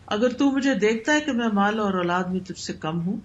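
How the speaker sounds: ordinary delivery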